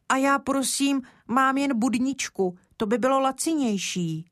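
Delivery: put-on a small boy trying to strike a deal; earnest little boy. Kája offers cheaper option.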